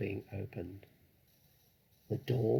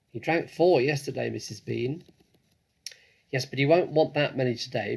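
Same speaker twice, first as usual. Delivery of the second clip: louder voiced exchange between the women; shouted dialogue; 2 semitones higher, 7 dB louder than usual. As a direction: call back and forth between floors. Mrs Bean and Mabel shouting.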